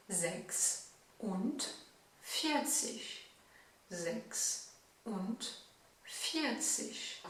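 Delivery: "Sechsundvierzig" drawn out syllable by syllable; very slow demo. Shown as a demonstration. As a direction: spell out "Sechsundvierzig" very slowly. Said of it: "quarante-six". (« Sechsundvierzig » très lentement, syllabe par syllabe)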